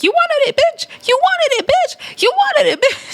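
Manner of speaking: very high hammed-up taunting yell, breaking into laughter; mock triumphant yell. [name] taunting Rella G during the fight, very high mock yell.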